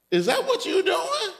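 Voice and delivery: king catching Haman, voice shooting high; outraged king. Acted. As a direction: jump up high in disbelief.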